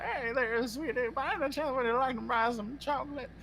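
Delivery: high, sing-song Southern granny; imitated Southern old-lady voice; Southern grandma. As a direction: coax like a sweet Southern grandma. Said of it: "you made that just sound like a very, like, wise, like, Southern grandma".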